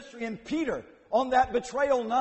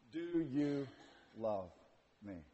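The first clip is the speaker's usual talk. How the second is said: much softer, lower and slow, almost pleading; soft third asking; 8 semitones lower, 11 dB quieter, slower than usual. Retold or imitated. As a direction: ask it gently, barely above a breath of sound. Jesus asks the third time.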